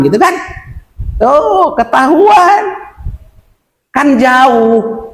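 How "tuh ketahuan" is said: ordinary talk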